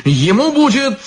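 very high, insistent cry. A narrator's reading. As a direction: push the pitch right up, insisting. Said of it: "повысил голос изящный чёрт".

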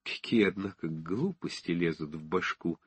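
soft, sheepish half-whisper; embarrassed whisper. Acted. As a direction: mutter it to yourself, ashamed. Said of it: "прошептал он сконфуженно".

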